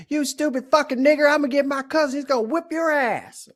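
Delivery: put-on angry little girl. Girl knocked down at the rink cursing him, high angry mock yell. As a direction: shriek it like a furious little girl. Retold girl's insult at rink.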